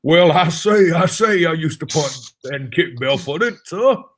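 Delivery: hammed-up southern rooster drawl; Foghorn Leghorn impression. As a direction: puff it out like a blustering cartoon rooster. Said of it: "foghorn leghorn thing you did".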